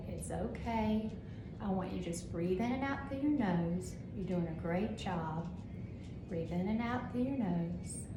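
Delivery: calm soothing voice. Shown as a demonstration. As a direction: gently settle a patient who feels sick. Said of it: "speak in a calm voice".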